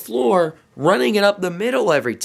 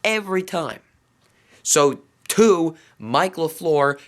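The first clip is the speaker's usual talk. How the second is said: normal talking voice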